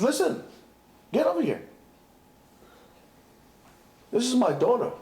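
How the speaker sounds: a hint of a commanding king summoning; stern king. King summoning the husband to his palace, mildly commanding. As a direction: call him in sharply. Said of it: "He says".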